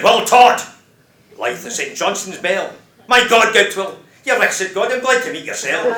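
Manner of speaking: put-on Will Todd blaring at God, loud drunken shout. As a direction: roar the greeting up at God like a drunk man. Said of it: "he breenged and blared".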